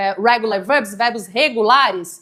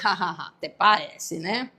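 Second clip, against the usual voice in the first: laughing aside; 6 semitones lower, 7 dB quieter, slower than usual. Real, not acted. Ironic laughing aside after example.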